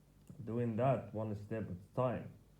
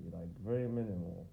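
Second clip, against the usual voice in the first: ordinary talk, nothing marked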